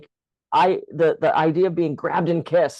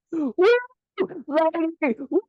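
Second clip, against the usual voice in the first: high, held-back whooping catcall; imitated catcall; 12 semitones higher, slower than usual. Construction boss jokingly hoots at her across the site, imitated catcall. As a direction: whoop like a builder hailing a passer-by. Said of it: "and I hear".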